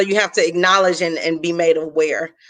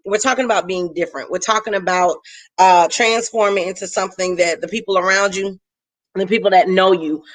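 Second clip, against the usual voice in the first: ordinary talk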